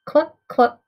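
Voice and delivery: loud imitated cluck; hen cluck. A narrator's reading. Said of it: "says the hen".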